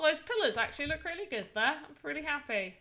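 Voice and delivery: ordinary delivery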